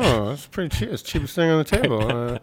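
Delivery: lightly voiced cheap-deal shopper; a hint of a put-on shopper's voice; tempted bargain buyer. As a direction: talk yourself into a bargain.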